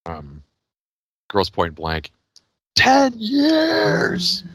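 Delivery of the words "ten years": high, half-crying ten years; Jeremy Piven impression. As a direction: wail it like a shocked old classmate. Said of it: "I always do the Jeremy Piven thing".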